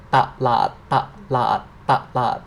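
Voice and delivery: "Ta, lat" repeated as a drill, each syllable clear; Thai syllables ta lat. Shown as a demonstration. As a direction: model "ta, lat" for students to echo. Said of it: "Repeat that again".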